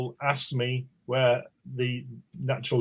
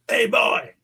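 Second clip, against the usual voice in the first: put-on high, loud call of an older American man; older American stranger; 9 semitones higher, 5 dB louder than usual. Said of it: "he was about sixty".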